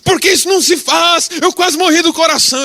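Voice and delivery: high, loud outraged shout; angry shout. Retold or imitated. Big driver shouting at the pastor after the near-crash. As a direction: yell it, still shaken and furious.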